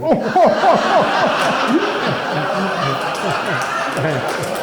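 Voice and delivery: long hearty burst of laughter; loud laughter. Real, not acted. Listener laughs at the punchline.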